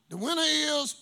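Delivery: slow, loud drawn-out announcement; announcer voice. Retold or imitated. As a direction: announce the winner grandly. Announcing the drawing winner.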